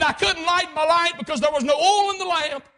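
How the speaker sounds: high, loud admission; impassioned confession. Acted. Watchman explaining his failure in court, impassioned confession. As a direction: cry out the confession.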